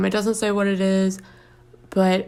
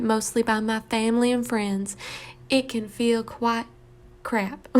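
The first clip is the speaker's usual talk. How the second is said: put-on woeful Southern accent. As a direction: read it as a self-pitying Southerner.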